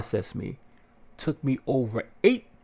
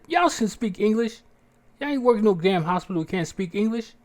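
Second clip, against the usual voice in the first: indignant scolding; 10 semitones higher than usual. Retold or imitated. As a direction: call the nurses out on faking. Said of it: "I was like".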